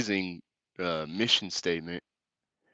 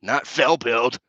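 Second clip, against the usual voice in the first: louder and higher, a put-on voice for the garbled phrase; mock announcement; 4 semitones higher, 6 dB louder, slower than usual. Mocking Sherry's typo by shouting it at passers-by, higher and louder. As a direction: belt the nonsense phrase like a proclamation.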